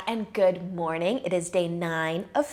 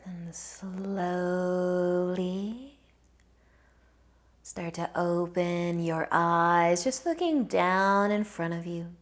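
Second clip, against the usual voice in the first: guided meditation; 4 dB quieter than usual. Acted.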